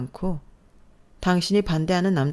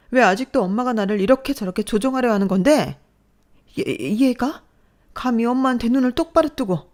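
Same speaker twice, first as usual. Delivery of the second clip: loud, higher, defiant outburst; trembling anger; 6 semitones higher, 5 dB louder than usual. Acted. Daughter confronting mother, shaking with anger. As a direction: finally fight back. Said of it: "부들부들 떨며 화를 내는 모습".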